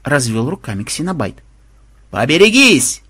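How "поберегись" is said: loud, raised cry of alarm; sudden warning yell. A narrator's reading. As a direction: call out a warning. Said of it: "вдруг завопил Макмед". (внезапный предостерегающий крик)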